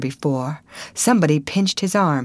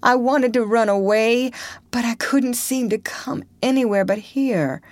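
high, broken explanation; tearful confession; 7 semitones higher than usual. A narrator's reading. Potter explaining to the crowd, tearful and high. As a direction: explain through tears. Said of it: "fell to sobbing again".

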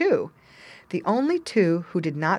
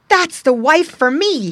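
tin soldier, louder and higher; loving tin soldier; 10 semitones higher, 10 dB louder than usual. A narrator's reading. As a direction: voice the smitten soldier. Soldier's inner thought voiced.

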